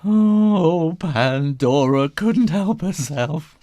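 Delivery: usual speaking voice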